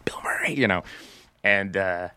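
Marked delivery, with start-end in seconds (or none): 0.0-0.5 hushed aside. Retold or imitated. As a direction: hush your castmates about the star nearby. Cast secretly hushing each other.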